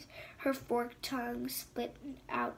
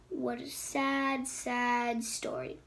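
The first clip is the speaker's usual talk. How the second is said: mock pity; slower than usual. Queen's mock sympathy.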